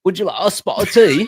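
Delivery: fast, loud, hammed-up posh British; British accent. Streamer does a requested British impression, hammed up. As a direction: toss off a bad British accent. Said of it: "Do the British one".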